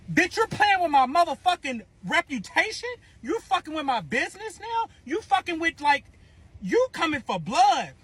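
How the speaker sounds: high-pitched incredulous questions; indignant confrontation. Acted. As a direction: fire accusing questions at him. Confronts him over her reputation.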